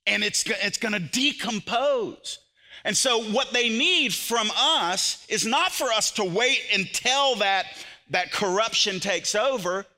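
high, loud preaching at full force; fiery preaching. Acted. Preacher urging the church not to wait, full-force preaching. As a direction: preach it hard to the congregation.

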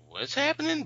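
confused surprise. Retold or imitated. Friend confused by crowd at glasses.

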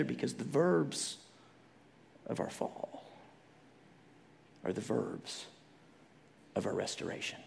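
soft, low, near whisper; hushed. Real, not acted. Preacher closing the point softly to listeners. Sermon point dropped to hush.

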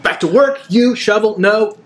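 military police shouting down a prisoner; barking MP. Acted. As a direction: snap at the worker like a gruff sergeant. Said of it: "somebody from S two going".

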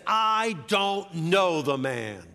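slow, loud, high emphatic denial; sworn denial. Acted. Peter denies Jesus on oath, emphatic denial. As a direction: swear it, drawing out each word. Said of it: "Peter takes an oath".

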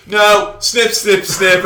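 "No, snip" called out loud and high; loud Peter Jackson call. Retold or imitated. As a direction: shout "snip, snip" like a director calling cut. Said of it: "Peter Jackson's like".

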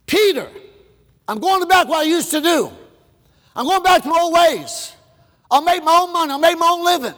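high, fed-up declaration; quitting Peter. Retold or imitated. Preacher voicing Peter quitting, fed up. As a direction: say it like you're done.

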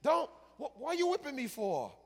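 high, loud, fast, complaining donkey; indignant donkey yelping at its master; talking donkey. Acted. Beaten donkey protests to the prophet, high and loud. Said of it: "the donkey looks at the prophet and says".

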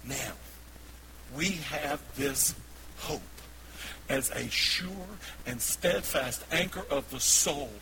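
slow, loud proclamation with weighty pauses; forceful scripture reading. Real, not acted. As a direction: declare the verse phrase by phrase, building.

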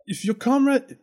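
high, lightly voiced dragon. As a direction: start the dragon's demand. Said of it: "I'm in dragon voice now".

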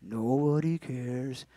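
slow, low, soft gloomy drone; Eeyore. Acted. Preacher mimicking low-spot self-pity, glum Eeyore voice. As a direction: mope in a drawn-out hushed voice. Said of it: "Remember Eeyore from Winnie the Pooh?".

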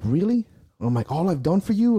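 high, loud, hurt yell; betrayed shouting; yelling like at a girlfriend. Retold or imitated. Lifter yelling at his sport like a cheating girlfriend, furious. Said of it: "I'm yelling like I'm talking to a girlfriend".

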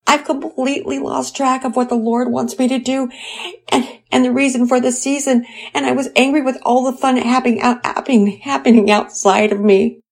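high, upset voice of a crying mother; tearful confession. Acted. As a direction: pour out the shame between sobs. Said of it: "I was crying in anger".

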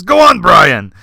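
loud, cheering him on; encouraging. Retold or imitated. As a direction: egg him on like a delighted bandleader. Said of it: "blessing and encouragement of him".

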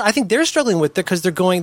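usual speaking voice

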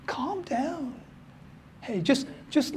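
quiet, soothing calm down; hushed reassurance; soft calm down. Acted. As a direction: settle them gently. Soothing after mock panic.

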